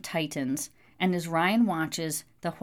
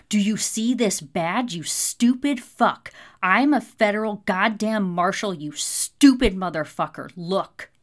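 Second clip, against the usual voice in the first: loud, forceful tirade at a cornered man; angry growl; 3 semitones higher, 7 dB louder than usual. A narrator's reading. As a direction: hammer out the insults in fury. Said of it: "Ryan growls into the man's face".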